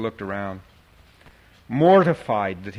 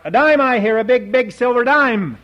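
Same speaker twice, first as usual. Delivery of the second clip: much higher, louder auctioneer cry; auctioneer's call; 11 semitones higher, 9 dB louder than usual. A narrator's reading. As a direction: sing out the bid like an auctioneer. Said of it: "his auctioneer's talk".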